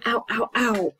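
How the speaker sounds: hammed-up little cries of back pain; mock ow of pain. Shown as a demonstration. To ESL class, acting out back pain, mock ow. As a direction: act out a sore back, playful ow ow ow. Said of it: "and then also".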